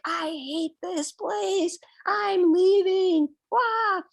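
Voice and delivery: high, slow, loud whining protest ending in a wail; childish tantrum. Retold or imitated. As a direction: throw a toddler's fit, stretching every word. Retelling her tantrum at retreat.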